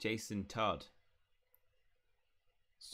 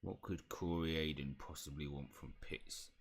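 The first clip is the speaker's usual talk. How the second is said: low, slow elf speaking of itself; slow, lowered creature voice; house elf voice; 4 semitones lower, 4 dB quieter than usual. Acted. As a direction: question them slowly, as a wary house elf. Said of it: "just the house elf".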